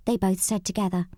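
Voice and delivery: usual speaking voice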